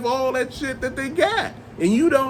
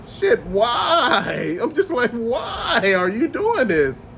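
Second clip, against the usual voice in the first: mock anguished plea; much slower than usual. Pleading with overbuying collectors.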